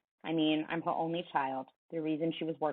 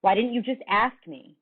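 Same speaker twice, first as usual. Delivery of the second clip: higher, louder demand; raised voice; 6 semitones higher, 5 dB louder than usual. Acted. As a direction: press hard on him. Coach pressing, raised voice.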